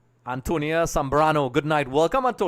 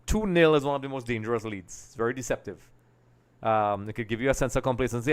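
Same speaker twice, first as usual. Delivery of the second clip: usual speaking voice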